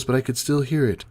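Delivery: ordinary delivery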